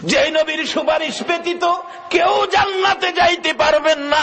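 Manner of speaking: high chanted exhortation; full-force preaching. Real, not acted. Preacher tells crowd none enters paradise without intercession, full-force preaching. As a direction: declare it as a soaring sermon warning.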